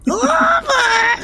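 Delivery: a high, loud shriek of laughter; loud burst of laughter. Real, not acted. Reacting to the Miss Piggy accent.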